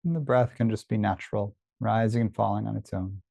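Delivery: unhurried body-scan guidance with long pauses; guided meditation. Real, not acted. Teacher leads listeners through a body scan, calm meditation voice. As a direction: guide attention gently, leaving space after each phrase.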